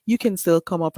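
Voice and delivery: normal talking voice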